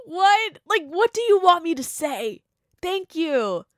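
exasperated disbelief; baffled exclamation. Real, not acted. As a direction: throw up your hands in disbelief. Reacting to the DMs.

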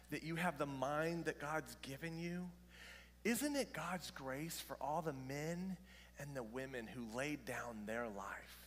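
ordinary talk, nothing marked

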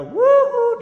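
very high, loud woo-hoo; exuberant whoop. Retold or imitated. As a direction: whoop it out like a thrilled preacher. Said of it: "and I go".

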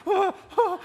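very high, loud mock-frightened yelps; panicked yelps. Preacher showing his strategy against the animal, high panicked yelps. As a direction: squeal "oh, oh" as a useless self-defence. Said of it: "This is apparently my strategy".

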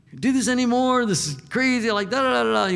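high, loud frustrated venting; hammed-up exasperated outburst; mock rant. Believer ranting at God, mock exasperated rant.

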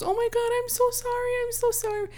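high, flustered apologetic lady voice. Retold or imitated. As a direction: gush apologies in a high fluttery voice. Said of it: "She goes".

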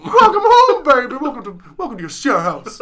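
high, loud, over-the-top welcome; welcoming someone home. Pretend. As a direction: gush like a hammy host showing off the house. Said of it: "my share impression gets worse and worse".

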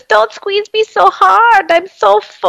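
high, slow squeaky Heimlich voice; rubbery high squeaky toy. Retold or imitated. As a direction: squeeze out a high squeaky caterpillar whine. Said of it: "And then he goes".

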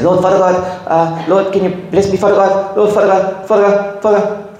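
gently lampoon the endless repetition of Father God; mimicking repetitive prayer. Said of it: "they say".

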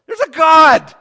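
loud, high exclamation; sudden shout. Real, not acted. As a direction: burst out with it.

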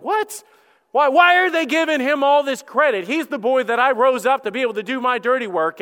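high-pitched mock-outraged disbelief; indignant outburst. Jealous king hearing David get the credit, indignant. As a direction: squeak the first word, then protest loudly.

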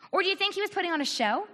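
normal talking voice

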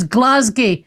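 Glasgow as GLAZ-gee; wrong Glasgow pronunciation. Shown as a demonstration. Host quotes what nobody says, Glasgow as GLAZ-gee. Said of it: "Nobody here says".

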